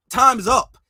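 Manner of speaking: Time's up called out loud and hard; loud slogan. Shown as a demonstration. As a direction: punch out Time's up like a chant. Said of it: "They was using that hashtag".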